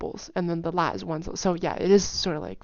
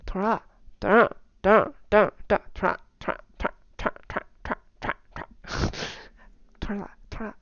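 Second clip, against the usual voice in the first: fast 土豆沙拉, consonants nearly dropped; even faster; 2 semitones higher, faster than usual. Shown as a demonstration. Teacher shows 土豆沙拉 said very fast and slurred. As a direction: rattle off 土豆沙拉 quick and slurred. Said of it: "if you said that even faster".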